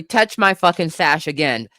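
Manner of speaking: normal talking voice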